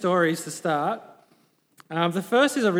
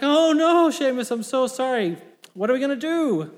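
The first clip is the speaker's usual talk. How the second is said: high, hammed-up apologetic wail; mock dismay; 6 semitones higher, 3 dB louder than usual. Farmer apologises to his neighbour, comic dismay. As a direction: open loudly in dismay, then apologise.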